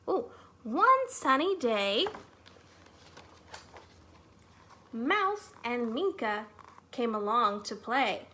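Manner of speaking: animated, slow storytime read-aloud; lively storytime narration for preschoolers; reading to children. Acted. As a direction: read it like a picture book to little kids. Reading picture book to children.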